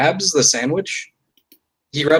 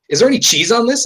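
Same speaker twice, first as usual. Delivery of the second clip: high, louder mock-indignant question; complaining customer; 10 semitones higher, 5 dB louder, faster than usual. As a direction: imitate a fussy customer demanding an answer. Voices complaining customer returning with sandwich.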